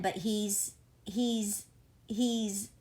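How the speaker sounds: halting repeats of he's with pauses between; stuttering. Acted. Teacher searching for words about Rowdy, stammering. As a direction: stumble over he's, unsure what to say. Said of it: "Mister P stuttered".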